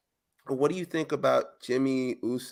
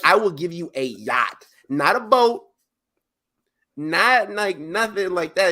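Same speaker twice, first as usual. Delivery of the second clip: higher, louder mock promise; mocking Roman; 5 semitones higher, 3 dB louder than usual. Retelling Roman offering Jimmy a yacht, mock hyped. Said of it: "He's like".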